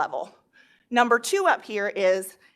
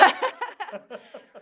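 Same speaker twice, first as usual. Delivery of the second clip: nervous laugh; 5 semitones higher than usual. Real, not acted. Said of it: "I'm just excited about this".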